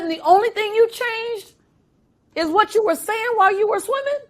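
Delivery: high, disbelieving question; amazed coach. Retold or imitated. Coach asking if only the chant changed, high amazed. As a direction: ask in disbelief. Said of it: "she said".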